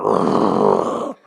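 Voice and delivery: low imitated growl; imitating the German Shepherd, low growl; dog growl. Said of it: "looked at me and went".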